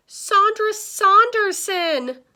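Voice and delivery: high, loud, drawn-out name; starstruck exclamation. A narrator's reading. Mister Morley sees a famous star, exclaiming. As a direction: cry out the name like a thrilled fan.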